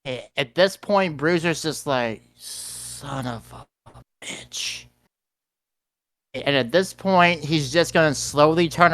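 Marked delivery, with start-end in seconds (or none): 2.4-4.8 very quiet, drawn-out curse; stunned muttered curse. Acted. Bruiser reacts in dismay, slow hushed curse. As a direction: let it out slowly under the breath.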